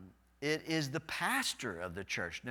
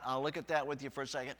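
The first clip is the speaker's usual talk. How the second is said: ordinary delivery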